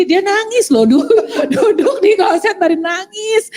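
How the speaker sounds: high-pitched, breaking into laughter; laughing disbelief. Real, not acted. Wife reveals big husband cried on the toilet, laughing. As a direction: tell it through rising laughter.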